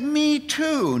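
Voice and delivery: high, excited "Me too."; eager me too. Retold or imitated. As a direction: pop it up high, delighted. Joke, speaker's eager reply.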